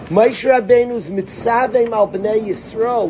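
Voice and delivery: usual speaking voice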